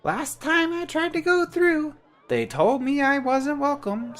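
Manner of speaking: very high, slow, squeaky sprite voice; a tiny bird-sized helper, cheerful; chirpy little creature. Acted. Jan introduces himself to the traveller, high and chirpy.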